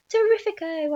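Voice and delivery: high-pitched jubilant outburst; delighted cry. A narrator's reading. Aunt celebrating with her sister, high gleeful cry. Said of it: "Aunt Sponge cried out".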